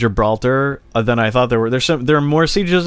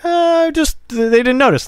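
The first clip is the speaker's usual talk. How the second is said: high, hesitant feigned innocence; mock innocent; 13 semitones higher than usual. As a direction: squeak out a fake excuse. Mock British excuse.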